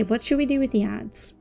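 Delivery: ordinary talk, nothing marked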